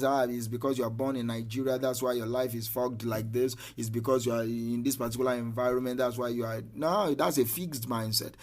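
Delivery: usual speaking voice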